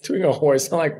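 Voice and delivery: ordinary delivery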